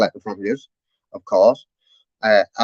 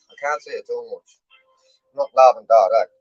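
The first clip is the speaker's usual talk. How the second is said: ordinary delivery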